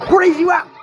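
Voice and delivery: high, loud whoop of recognition; excited shout. Real, not acted. Commenter greets his favorite character's appearance, excited shout. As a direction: call it out high and loud, thrilled.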